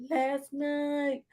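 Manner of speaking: voce alta e spezzata; high, soft, breaking. Shown as a demonstration. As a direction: squeak it out, almost crying. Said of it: "Bitch, last night".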